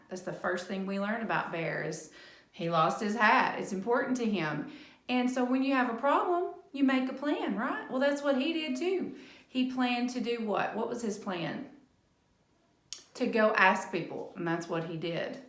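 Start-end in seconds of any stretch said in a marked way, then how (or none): none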